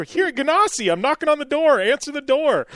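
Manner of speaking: hammed-up Steve demanding to be let in; voicing impatient Steve. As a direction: insist he's knocking right now. Caller voiced in story.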